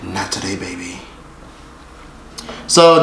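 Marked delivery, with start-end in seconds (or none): 0.0-1.0 very soft, low sassy brush-off. Retold or imitated. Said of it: "In my [name] voice".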